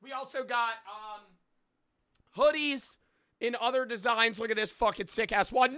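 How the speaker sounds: high, loud, pumped-up sales pitch; over-the-top promo read; hype merch ad. Acted. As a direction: sell the merch like a yelling hype man. Hypes his merch drop.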